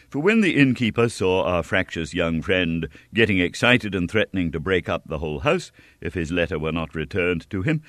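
usual speaking voice

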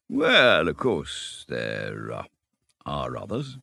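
reluctant, unwilling to name them; hesitating. Acted. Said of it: "in a hesitating sort of way".

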